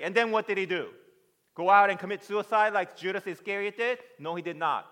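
loud, high, full-force sermon; fervent shouted preaching; impassioned preaching. Real, not acted. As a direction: preach it at the top of your voice.

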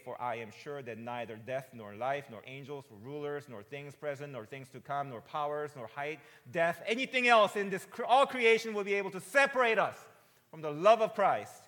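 starts firm, swells to a loud, high cry; building scripture reading. Real, not acted. Preacher reading Romans to his church, swelling to a shout. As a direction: build each "nor" toward a shout.

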